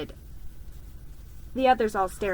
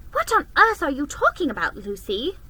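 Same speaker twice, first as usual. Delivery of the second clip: put-on puzzled older sister; 6 semitones higher, 6 dB louder, faster than usual. A narrator's reading. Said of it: "said Susan".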